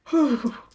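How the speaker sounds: loud, high exhale of relief; huge sigh after a fright; heavy sigh. Real, not acted. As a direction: blow out a big shaken breath. Recovering from a real fright.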